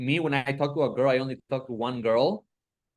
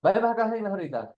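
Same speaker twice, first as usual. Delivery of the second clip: raised, higher call across the room; Spanish call to come here, pitched up; 5 semitones higher, much faster than usual. Real, not acted. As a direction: call her over from a distance. Calling someone to come over.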